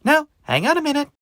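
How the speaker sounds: high, quick, perky protest; cheery little pumpkin. Acted. Christopher stopping the angry witch, high and eager. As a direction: pipe up brightly as a small pumpkin.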